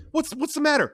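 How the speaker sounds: frightened asking; Mama asking Sonny what happened, anxious. Acted. Mama worried.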